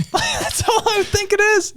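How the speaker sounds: high laughing aside; giggly amused comment. Real, not acted. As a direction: chuckle through it.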